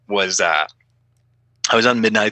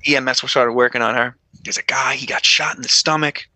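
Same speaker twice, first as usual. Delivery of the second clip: ordinary delivery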